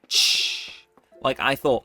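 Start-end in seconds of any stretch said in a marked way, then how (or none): 0.1-0.7 a short unvoiced shush into the mic; demo shush. Shown as a demonstration. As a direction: hiss a quick shh to test the filter. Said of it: "if I go, for example, like".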